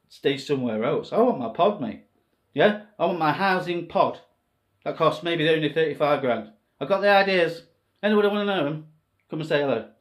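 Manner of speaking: usual speaking voice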